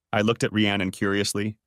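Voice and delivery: plain reading voice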